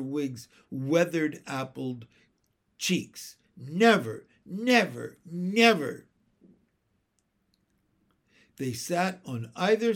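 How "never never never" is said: each "never" punched louder; emphatic. A narrator's reading. Jane kissing the uncle goodbye, each "never" emphatic. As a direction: insist on every "never" separately.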